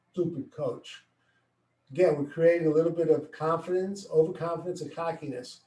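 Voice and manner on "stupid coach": soft muttered grumble; muttered under breath. Retold or imitated. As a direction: sulk it under your breath. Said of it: "He mumbles under his breath".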